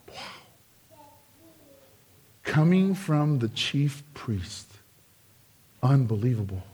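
low, soft aside; murmured low in disbelief; hushed disbelief. Real, not acted. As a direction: let it drop in quiet dismay. Preacher reacts to the priests' answer.

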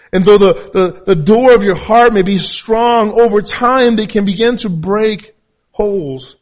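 high, loud, emphatic sermon delivery; full-force preaching. Real, not acted.

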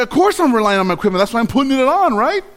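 high, fast and know-it-all; cocky. Retold or imitated. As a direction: brush it off as obvious. Retold cocky reply.

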